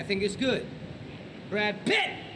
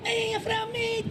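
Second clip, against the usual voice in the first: high-pitched Liverpool accent; Scouser accent; 11 semitones higher than usual. Shown as a demonstration. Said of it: "So here's, I think, a Scouser".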